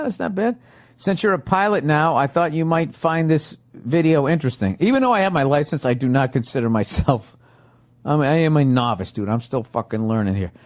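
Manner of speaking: normal talking voice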